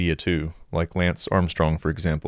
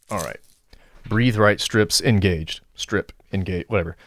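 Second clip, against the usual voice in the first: ordinary talk, nothing marked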